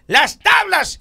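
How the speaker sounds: high panicked cry of a priest losing his house; panicked shout. Acted. Priest watching his house blow away, shouting in alarm. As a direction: yell it out as the roof flies off.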